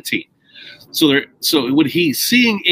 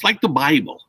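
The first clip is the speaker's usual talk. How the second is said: ordinary talk, nothing marked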